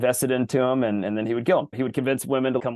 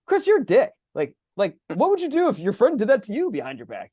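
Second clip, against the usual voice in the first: high, louder scolding conscience; inner voice; 10 semitones higher, 3 dB louder than usual. Retold or imitated. Conscience scolding Chris at lunch, high and accusing. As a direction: nag yourself in a squeaky accusing voice. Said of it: "You know that little voice".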